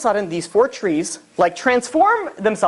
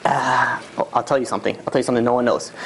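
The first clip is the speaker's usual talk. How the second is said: low, hushed, conspiratorial junkie; shifty man confiding in a stranger; furtive street addict; 7 semitones lower, 4 dB quieter, faster than usual. Acted. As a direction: draw them in with a secret, low and halting. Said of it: "then you see him, like, glancing".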